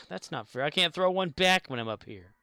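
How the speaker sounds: ordinary talk, nothing marked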